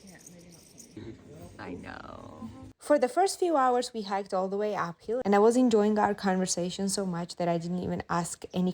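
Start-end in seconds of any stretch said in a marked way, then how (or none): 0.0-2.5 quiet aside. Real, not acted. Self-mocking aside, very quiet.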